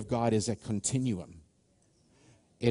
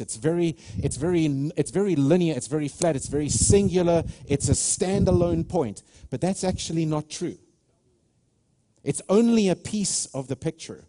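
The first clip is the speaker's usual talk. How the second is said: raised, louder sermon voice driving the point; preaching at full force; 4 semitones higher, 5 dB louder than usual. Acted. Pastor preaching to the church at full force. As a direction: preach it hard to the congregation.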